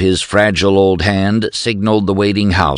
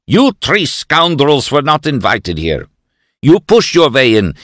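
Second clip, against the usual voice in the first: put-on louder, indignant old professor; crying out; 7 semitones higher, 4 dB louder than usual. A narrator's reading. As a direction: order the intruders away.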